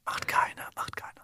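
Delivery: whispered reassurance that nobody does it; conspiratorial whisper. Real, not acted. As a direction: hush it back, barely voiced. Agreeing in the secret whisper.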